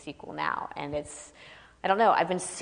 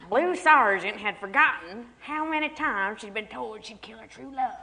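mock Southern accent, higher and louder, laughing through it; fake Southern accent; 5 semitones higher, 5 dB louder than usual. Shown as a demonstration. Author parodying the LA narrators' fake Southern accent, laughing. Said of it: "I'm not gonna read it like that".

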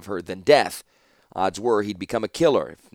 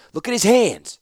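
high, loud, desperate; high, loud frantic protest; frantic insisting; 12 semitones higher, 7 dB louder than usual. A narrator's reading. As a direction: make her see, frantic. Distraught man insists loudly.

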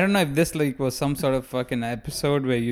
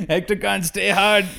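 teasing laugh; 6 semitones higher, 4 dB louder than usual. Acted. Teasing callback.

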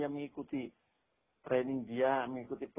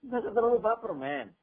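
put-on higher, louder casual "Nggak usah terlalu baper, men" like young people talk; youth slang; 7 semitones higher, 6 dB louder than usual. Shown as a demonstration. Preacher imitates young people's slang, louder and higher. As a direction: toss it off like a trendy teen. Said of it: "bahasa anak mudanya sekarang".

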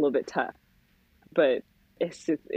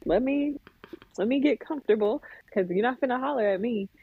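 acted compliant child; 7 semitones higher than usual. Child's reply to bossy parents.

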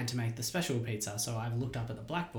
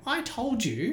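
a cocky, raised-pitch "I told you"; smug comeback; 12 semitones higher, 4 dB louder, much slower than usual. Retold or imitated. Nineteen-year-old cheeking his Italian boss, smug comeback. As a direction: throw it back like a know-it-all teenager.

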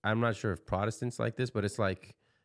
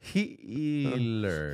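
long, exaggerated vowel on "Healers"; drawn-out name; 4 semitones higher than usual. Shown as a demonstration. Joking to a co-host about a changed surname, drawn out. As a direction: stretch "Healers" out very slowly.

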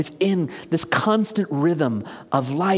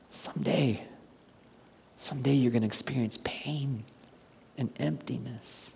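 ominous whisper; 4 semitones lower, 10 dB quieter than usual. Shown as a demonstration. Preacher warning those who have everything, grave whisper. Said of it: "Do you hear the difference?".

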